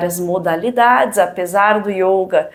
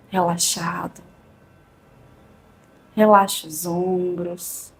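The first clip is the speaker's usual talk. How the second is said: calm and unhurried, long pauses; soothing spa whisper. Acted. Leads a guided meditation.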